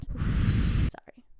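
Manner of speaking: exasperated breathy sigh; frustrated sigh. Real, not acted. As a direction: let out a weary sigh. Frustrated after a flubbed take.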